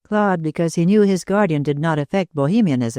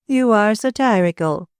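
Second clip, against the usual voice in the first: lightly raised, gushing lady's voice; gushing society hostess; 6 semitones higher than usual. A narrator's reading. Hostess gushing to Claude about Tommy, lightly raised voice. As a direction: coo over the guests like a fond hostess.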